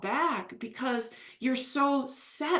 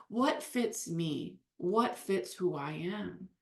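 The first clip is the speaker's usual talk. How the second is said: slow reflective question; 4 semitones lower, 5 dB quieter, slower than usual. Retold or imitated. Imagined person asking themselves slowly.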